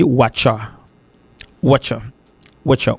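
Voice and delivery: "watcher" as "watcha", no R; R dropped. Shown as a demonstration. Teacher showing learners the form without R, dropped R. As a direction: merge what and you into "watcha". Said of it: "si vous pas prononcer R là du tout".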